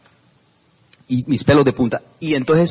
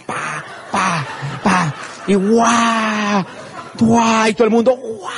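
crowd cheer; 6 semitones higher, much slower than usual. Retold or imitated.

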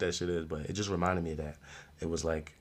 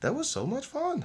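slow, high relieved exhale into easy delight; lightly imitated chill cheerfulness; imitating calm character. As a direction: let out a whew, then take it easy. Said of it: "her voice was just calm".

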